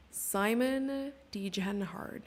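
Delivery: slow, drawn-out American accent on Simon Degenhard. Shown as a demonstration. Streamer demoing the most American accent on Degenhard. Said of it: "I'm gonna do it in the most, like, American accent ever".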